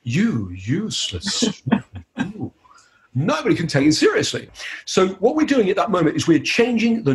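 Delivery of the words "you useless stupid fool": slow, soft, seductive purr breaking into a laugh; sexy voice. Shown as a demonstration. Hypnotist turning an insult into a sexy purr. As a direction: make the insult sound seductive. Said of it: "when we make it sound sexy".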